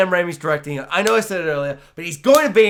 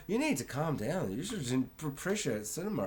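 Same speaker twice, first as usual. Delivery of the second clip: much softer, lower condescending calm; mock calm scolding; 4 semitones lower, 15 dB quieter than usual. Friends telling him to calm down, soft snooty scolding. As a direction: hush him down like a snob.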